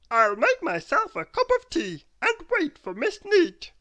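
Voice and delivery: very high, loud, daft man's voice; a high, loud, dotty "oh dear"; silly Mister Muddle. A narrator's reading. Mister Muddle finding nobody home, high and loud.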